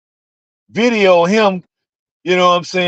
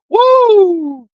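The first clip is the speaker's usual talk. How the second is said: excited whoop; 13 semitones higher, 3 dB louder than usual. Real, not acted. Reacting to politician's nerve.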